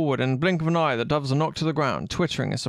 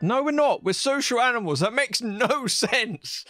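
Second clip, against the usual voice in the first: high, indignant outburst breaking into a laugh; excited protest; 10 semitones higher than usual. Acted. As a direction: yell it like an outraged girl. Jam protests loudly.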